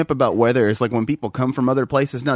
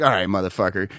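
sarcastic; 6 semitones lower than usual. Retold or imitated. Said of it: "And I'm like".

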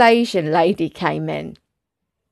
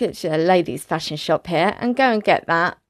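ordinary talk

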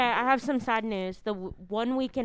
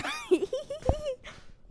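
high squealing laugh; silly high laugh. Pretend. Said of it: "I love doing that laugh".

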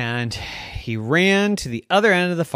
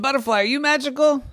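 high quick plea; 12 semitones higher, much faster than usual. Acted. Hopeful quick question.

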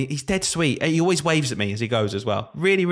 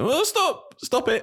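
high pleading; 13 semitones higher, much slower than usual. Retold or imitated. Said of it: "I was like".

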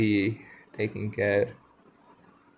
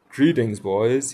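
louder hail, lightly acted; cried greeting; 2 semitones higher, 9 dB louder, slower than usual. A narrator's reading. Peter drops in before his band, crying a greeting. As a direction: call out a brisk storybook greeting. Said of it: "he cried".

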